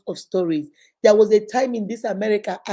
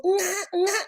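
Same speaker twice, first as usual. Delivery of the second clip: put-on 1 baby cry; 10 semitones higher than usual. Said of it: "The next thing I had was".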